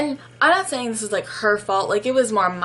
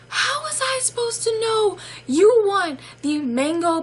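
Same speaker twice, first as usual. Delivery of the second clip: high-pitched mock incredulity; squealed disbelief at full voice; exasperated outburst; 10 semitones higher than usual. Exasperated reaction, second take aloud.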